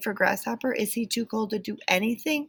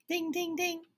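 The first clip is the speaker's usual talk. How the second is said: doorbell; 11 semitones higher, 6 dB quieter than usual. Acted. Doorbell imitated.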